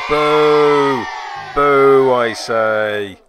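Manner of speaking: loud hammed-up pantomime boo; mock booing. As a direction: boo the villain, theatrically pompous. Booing opponent's tackle like panto.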